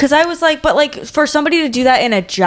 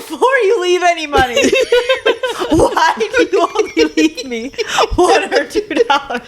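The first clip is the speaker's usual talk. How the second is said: higher and laughing through the line; 8 semitones higher than usual. Real, not acted. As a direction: reread it while cracking up. Rereading letter laughing.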